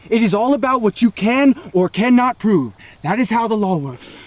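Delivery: heated yelling; angry shouting. Acted. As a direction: lay into him hard. Heated argument about police.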